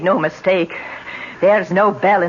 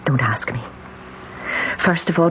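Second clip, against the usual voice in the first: normal talking voice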